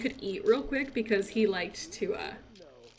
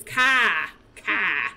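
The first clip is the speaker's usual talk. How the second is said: car said British, cah with no r, louder; 4 dB louder than usual. Retold or imitated. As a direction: mimic a toddler's British car. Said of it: "he says car like a British person".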